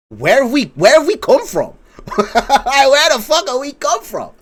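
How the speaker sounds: high-pitched, incredulous outburst breaking into laughter; baffled reaction. Retold or imitated. Retold baffled reaction on phone.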